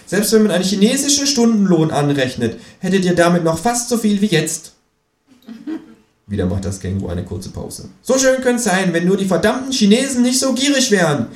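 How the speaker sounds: high, mock-wistful kangaroo voice. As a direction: sigh it out in a high voice. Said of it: "sagt das Känguru".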